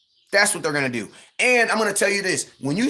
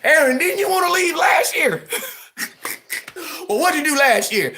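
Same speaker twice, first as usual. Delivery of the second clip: laughing shout; 10 semitones higher than usual. Real, not acted. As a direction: yell it while cracking up.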